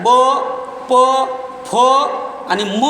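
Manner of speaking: ब, प, फ, म each sounded alone with the lips; isolated lip sounds ब, प, फ, म; labial sounds demonstrated. Shown as a demonstration. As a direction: sound out ब, प, फ, म one by one, pausing between. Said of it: "ओष्ठी सोन आहेत".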